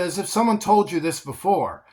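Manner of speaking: ordinary talk, nothing marked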